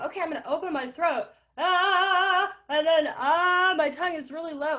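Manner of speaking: loud, high, pressed strangled tenor. Shown as a demonstration. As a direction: push the sound out, throat squeezed. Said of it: "my strangled tenor impression".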